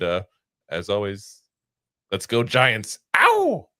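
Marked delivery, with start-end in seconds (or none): 3.2-3.7 high, loud "Ow!" cheer; excited whoop. Real, not acted. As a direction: yelp it out like a fan celebrating. Cheering sign-off after let's go Giants.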